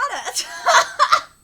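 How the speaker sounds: high, loud real laugh; sudden high cackling laugh; loud burst of laughter. Real, not acted. Reacts to a dead cicada.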